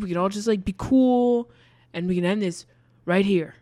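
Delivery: loud, tense warning to back off; threatening. Retold or imitated. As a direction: threaten him to stand down, loudly. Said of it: "he's like".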